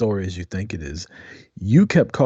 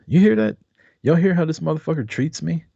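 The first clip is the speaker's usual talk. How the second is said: high-pitched, indignant appeal; mock outrage; 7 semitones higher than usual. Left alone with the chat after being insulted, mock-indignant.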